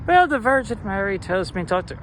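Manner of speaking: mock passive-aggressive grumble. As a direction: complain with a loud put-on sigh, then lift the pitch. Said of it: "passive aggressively".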